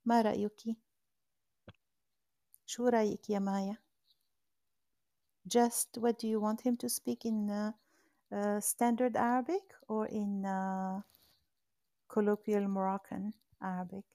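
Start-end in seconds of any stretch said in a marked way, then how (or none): 5.6-14.1 slow, careful English for a learner; slow and clear. Real, not acted. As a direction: spell it out slowly. Host slows down for learner.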